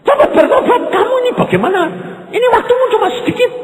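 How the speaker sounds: teriakan keras dan tinggi, setengah tertawa; semangat. Retold or imitated. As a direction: berseru lantang penuh semangat. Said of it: "aku ya semangat". (pendeta bersemangat menegur orang itu, berteriak keras dan tinggi)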